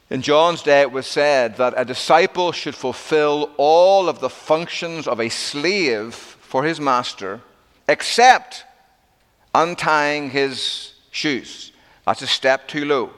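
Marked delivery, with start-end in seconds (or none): none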